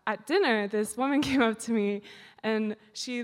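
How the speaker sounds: ordinary talk, nothing marked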